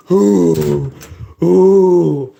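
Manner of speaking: strained ooh yell. Retold or imitated. Worrier imitating a voice-straining yell. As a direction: yelp like losing your voice. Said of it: "I'm gonna go".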